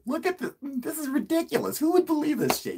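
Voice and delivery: very high mocking disbelief; condescending. Caller belittles the video to interviewers, real condescending. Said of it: "I was real condescending".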